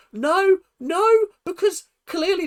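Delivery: high, loud, alarmed refusal; emphatic protest. Acted. Viewer objecting to the demon confession, emphatic protest.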